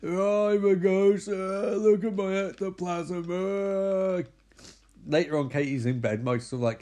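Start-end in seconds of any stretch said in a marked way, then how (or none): 0.0-4.3 high, drawn-out spooky wail; mock ghost. Teller pretends to be the ghost, spooky wail. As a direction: wail slowly like a hammed-up ghost. Plays a ghost, calls himself one.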